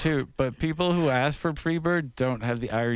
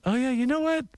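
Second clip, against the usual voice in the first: mock audience voice; 9 semitones higher than usual. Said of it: "I could just see people going".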